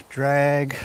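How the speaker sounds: normal talking voice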